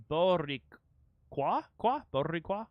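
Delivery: "Boricua" with a heavily trilled R; overdone rolled R. Shown as a demonstration. Streamer nervously practising the word, overdoing the rolled R. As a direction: roll the R hard in "Boricua", trying it out. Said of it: "I may be overdoing the roll".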